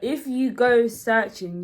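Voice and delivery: laughing. Real, not acted. Laughing while giving advice.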